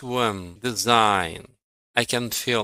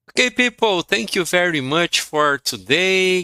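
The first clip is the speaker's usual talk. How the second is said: bright farewell; 7 semitones higher, 4 dB louder than usual. Real, not acted. Teacher's cheerful class farewell.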